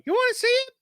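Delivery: squeaky high cartoon bird; high-pitched silly bird voice. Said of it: "And he's just back and forth like".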